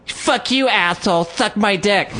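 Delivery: high-pitched mock screech; mimicked parting shot. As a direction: fling insults in a put-on high voice. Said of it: "like a little parting shot".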